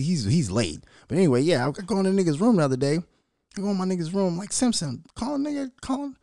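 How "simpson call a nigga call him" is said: calling out in a high voice. Retold or imitated. Calling son's name.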